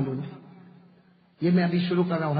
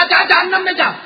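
higher, louder mock-angry boss yelling; angry shout; 9 semitones higher, 7 dB louder than usual. Angry boss telling the uncle to go to hell, raised mock shout. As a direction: throw the angry boss's curse out loud. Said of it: "वो सेठ ने गुस्से में कहा".

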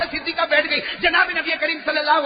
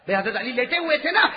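ordinary delivery

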